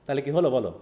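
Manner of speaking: ordinary delivery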